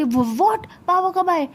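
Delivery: jolted by bad news; shocked. Shocked to hear father arrived.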